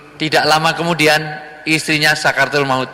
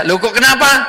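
high, startled "Loh"; puzzled concern, pitched up; a surprised question, higher and louder; 10 semitones higher, 4 dB louder than usual. Acted. Prophet asks weeping man.

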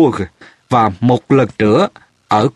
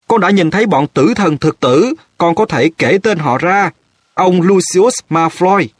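higher, urgent story-reader's cry; narrated shout; 7 semitones higher than usual. A narrator's reading. Harry telling Fudge he saw the Death Eaters, mild shout. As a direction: pitch it up as a restrained shout. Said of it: "Nó la lên".

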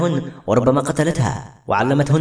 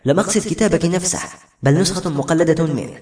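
usual speaking voice

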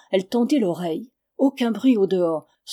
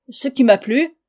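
higher and much louder, defiant; insolent; 5 semitones higher, 8 dB louder than usual. A narrator's reading. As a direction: throw it back in his face. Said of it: "dit-elle avec insolence".

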